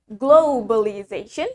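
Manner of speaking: "globalization" with a drawn-out "glow" ending in a W glide; British-style "globalization", long rounded first vowel. Shown as a demonstration. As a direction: stretch the O of "globalization" into "oh-w". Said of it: "In British, we say it".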